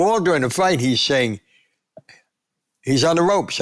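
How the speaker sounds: normal talking voice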